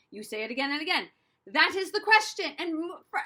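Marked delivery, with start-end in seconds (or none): 1.5-2.5 higher, louder line rehearsal; projected practice line. Shown as a demonstration. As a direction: say it out loud, firmly. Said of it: "saying it out loud".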